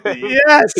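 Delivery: gleeful loud confirmation; excited yes. Real, not acted. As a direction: confirm it with a thrilled shout. Confirming the surprise reveal.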